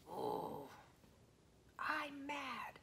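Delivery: put-on a playful whispered I'm mad for the kids; mock mad. Shown as a demonstration. Teacher showing children a mad face, mock mad. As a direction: pretend to be mad, half in fun. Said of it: "Like this".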